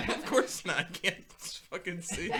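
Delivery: half-laughing mock indignation; slow amused retort; laughing quote. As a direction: laugh through the punchline. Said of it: "And he's like".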